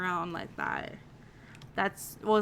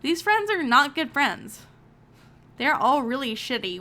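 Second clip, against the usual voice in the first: louder, fed-up verdict on the friends; indignant; 4 semitones higher, 8 dB louder than usual. Real, not acted. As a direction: declare it loudly, taking her side. Indignant on daughter's behalf.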